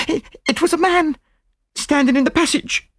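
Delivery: very high, stammering maid; frightened maid. Acted. Startled maid reports an intruder.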